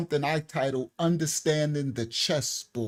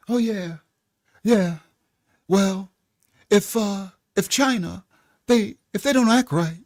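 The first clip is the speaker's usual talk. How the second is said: mock Trump impression; 3 semitones higher, 4 dB louder than usual. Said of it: "let me try to mock him".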